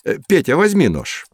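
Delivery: ordinary talk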